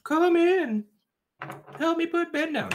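high-pitched imitation of his wife calling. Telling friends how his wife lured him in, mock wife voice. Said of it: "my wife's all like".

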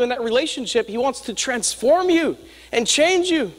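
preaching. Acted.

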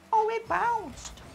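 very high, slow squeal of dismay; high-pitched whine. Real, not acted. Gamer watching the ball bounce, high-pitched whine.

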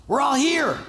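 calling out high to stop him; Paul shouting to the jailer not to harm himself. Retold or imitated. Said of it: "But Paul shouted".

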